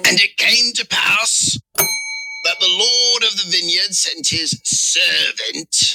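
high, slow pirate declamation; hammed-up pirate scripture reading. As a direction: read it as a drawn-out, high-pitched pirate. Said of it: "Pirate voice, please".

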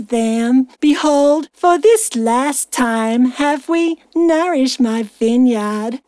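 put-on slow, careful American accent; attempted American accent scripture reading. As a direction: read it in a laboured American accent. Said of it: "I'll try American".

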